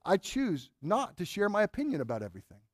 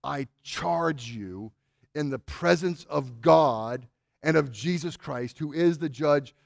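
very slow, weighty, lower-pitched charge; emphatic solemn charge; 4 semitones lower, slower than usual. Retold or imitated. Paul near death charging Timothy, slow and emphatic. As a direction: stretch each word out with grave emphasis. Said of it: "listen to how, how emphatically he says this".

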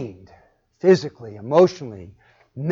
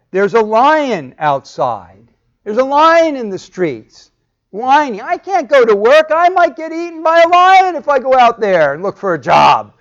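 whining sluggard; 10 semitones higher, 12 dB louder than usual. Retold or imitated. The sluggard whining to avoid work, high mock whine. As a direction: whine like a lazy man dodging work.

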